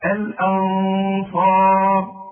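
الأنصار drawn out slowly, nun hidden with a held nasal ghunna; تلاوة بطيئة بإخفاء; slow tajweed recitation. Shown as a demonstration. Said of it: "شوف النطق".

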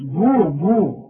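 بو drawn out very slowly with a rounded, heavy vowel; mock the student's wrong بو; imitating the mistake. Teacher mocking a wrong reading. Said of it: "الأول بتقول لي بو ليه".

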